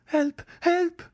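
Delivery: very high, loud alarm call; shrill high cries for help; crying for help. A narrator's reading. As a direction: shout for help in panic. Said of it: "cried Mademoiselle d'Armilly".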